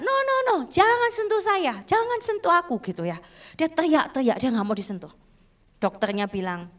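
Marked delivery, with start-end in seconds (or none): 0.0-2.8 high-pitched mock cry of refusal; imitated child's yelling. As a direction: imitate a child crying out not to be touched, pitched way up.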